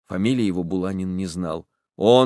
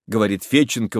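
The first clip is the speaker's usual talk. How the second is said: ordinary delivery, nothing marked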